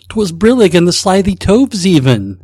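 Snagglepuss impression. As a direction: try a bad cartoon-lion voice. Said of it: "In your best Snagglepuss voice".

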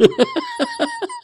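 squealing high-pitched giggle; high helpless laugh. Real, not acted. Host losing it at his wife's song, high squealing laugh. Cracking up at wife.

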